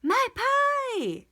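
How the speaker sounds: high, loud sudden cry of alarm; suddenly remembering the pie in the oven; alarmed exclamation. A narrator's reading. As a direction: yelp of dismay. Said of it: "exclaimed Amelia Bedelia".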